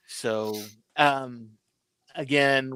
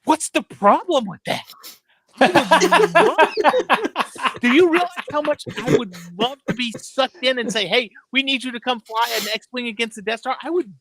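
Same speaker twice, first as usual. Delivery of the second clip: very high, louder, slowed on I would love that; gushing excitement; 10 semitones higher, 6 dB louder than usual. Retold or imitated. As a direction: beg like it's your wildest dream come true. Kid gushing over the movie idea.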